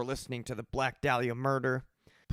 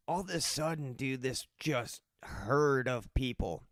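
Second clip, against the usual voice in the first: usual speaking voice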